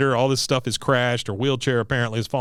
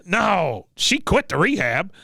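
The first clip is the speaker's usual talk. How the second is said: high-pitched blunt veteran impression; old soldier's curt announcement; Cotton; 6 semitones higher, slower than usual. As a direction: cut in as a cranky old man, pitched up. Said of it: "Cotton says".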